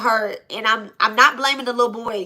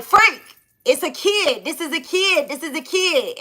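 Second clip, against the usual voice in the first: urgent pleading; 6 semitones higher than usual. Retold or imitated. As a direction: plead urgently, imitating a desperate moment. Retold pleading with gunman.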